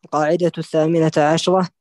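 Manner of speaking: usual speaking voice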